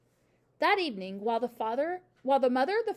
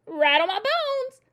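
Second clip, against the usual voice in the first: loud, high, drawn-out spooky call; spooky parrot; 10 semitones higher, 9 dB louder, slower than usual. A narrator's reading. Parrot showing off for the children, spooky voice. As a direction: parrot imitating a ghostly voice, slowly. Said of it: "imitation of a spooky voice".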